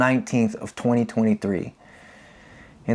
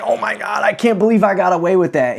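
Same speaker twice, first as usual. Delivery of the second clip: mock relieved disbelief; 7 semitones higher than usual. Retold relief thought.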